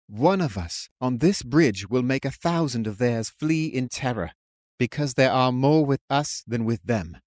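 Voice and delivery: mildly impassioned exhortation; earnest rant. A narrator's reading. Believer rallying his doubting friend on the bridge, fervent. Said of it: "watched his friend ranting".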